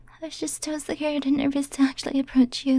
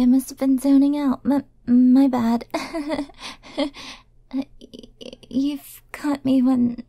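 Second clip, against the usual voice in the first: halting, stammered denials with nervous giggles; nervous stutter. Acted. Caught admirer denies knowing darling, nervous stutter. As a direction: trip over first words, dragging them out. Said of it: "uh, stuttering?".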